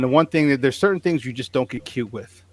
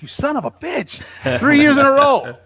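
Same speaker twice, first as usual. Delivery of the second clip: high, exasperated mock cry; mock outrage; 10 semitones higher than usual. Retelling the pro day measurement, hammed-up outraged cry. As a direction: cry out in comic disbelief.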